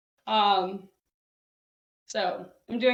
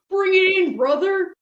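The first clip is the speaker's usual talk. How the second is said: loud, higher bro greeting; mock hippie bro; 8 semitones higher, 7 dB louder than usual. Mocking the hippie who greets people with a bro hug, loud. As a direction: boom it out like a hug-happy bro. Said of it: "instead of going".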